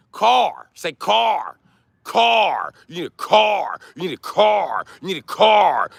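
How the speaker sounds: "car" said high with a full hard k and r; car said carefully. Shown as a demonstration. Streamer teaching Chicago viewers to say car, high and clear. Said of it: "learn how to say car".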